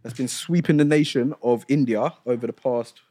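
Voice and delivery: big, drawn-out show-opener into a news read; lifted, punchy news-read delivery; news announcer. Acted. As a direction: present the headline like a news host. Presents the show's news segment.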